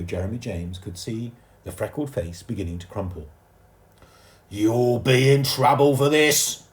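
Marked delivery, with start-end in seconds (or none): 4.5-6.7 slow, very loud threat; roar. A narrator's reading. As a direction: roar like a Tyrannosaurus. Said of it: "roared the fiery furry man".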